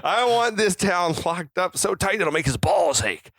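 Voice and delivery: mock movie tough-guy order; put-on voice for a film line; James Caan. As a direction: deliver a hammed-up lawman's command. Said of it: "James Caan can get away with delivering any lines".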